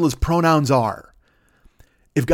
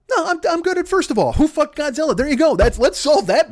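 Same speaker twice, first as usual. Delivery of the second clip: excited rant; 11 semitones higher, 4 dB louder than usual. Real, not acted. Self-correcting rant.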